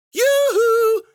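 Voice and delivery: high, loud long-distance holler; piercing sing-out call. A narrator's reading. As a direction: hail someone far across the desert. Susie calling across the desert.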